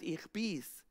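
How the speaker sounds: calm the frightened disciples; reassuring Jesus. Acted.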